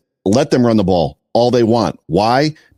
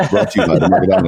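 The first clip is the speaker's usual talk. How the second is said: fast, high sponsor plug; joking announcer read, pitched up; show-open announcer; 7 semitones higher, much faster than usual. Pretend. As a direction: rattle it off like a show intro. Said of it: "about to do my open for the show".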